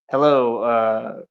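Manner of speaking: low, hesitant greeting; tentative question, mildly wary; nervous, unsure. Acted. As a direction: feel out the name with halting pauses. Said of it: "it's really scary".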